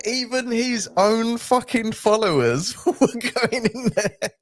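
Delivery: usual speaking voice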